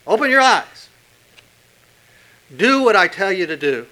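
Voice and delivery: loud, commanding preacher voice; stern command. Acted. Preacher voicing Jesus ordering the healed to see, loud and stern. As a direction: drive the command home. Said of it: "using a word that says".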